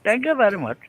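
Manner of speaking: put-on high-pitched comic Indian accent. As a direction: say thanks in a sing-song Indian accent. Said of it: "It's Indian or Ara".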